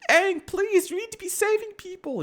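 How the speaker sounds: high, fast squeaky pleading; high mock plea. Past Avatars nagging Aang, high-pitched mock plea.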